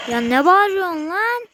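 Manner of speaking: irritated loud holler; angry shout. Real, not acted. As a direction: snap back at the screaming monster. Yelling back at game monster.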